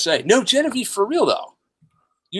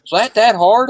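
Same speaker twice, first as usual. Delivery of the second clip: Southern drawl; 6 semitones higher, 5 dB louder than usual. Retold or imitated. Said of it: "if you'd have heard the drawl".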